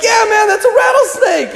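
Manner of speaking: high-pitched mock panic; freaked out. Player answering the coach about the snake, mock panic. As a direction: imitate a panicking player crying out. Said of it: "And the kids freak out".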